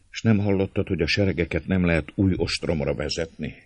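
ordinary delivery

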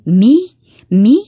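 loud, rising screech; sharp old woman's loud query. A narrator's reading. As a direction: screech the question like a deaf old lady. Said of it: "kérdezte a néni rikácsoló hangon".

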